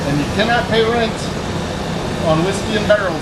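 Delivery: a bad, lightly done Scottish accent on "you cannot pay rent"; mock Scottish brogue, barely there; terrible Scottish accent. Shown as a demonstration. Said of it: "My terrible Scottish accent".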